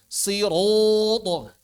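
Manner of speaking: siratu slowly drawn, kept thick; heavy recitation. Shown as a demonstration. Teacher repeats the word slowly for students. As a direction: draw out siratu heavily. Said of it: "Jangan dibalik".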